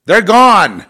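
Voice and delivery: loud, high burst of finality; emphatic shout. Real, not acted. Declaring people deleted for good, emphatic shout.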